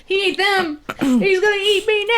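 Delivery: high, whiny fake dread; mock panic. Player fearing the game master's character will eat her, mock panic. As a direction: wail in hammed-up panic.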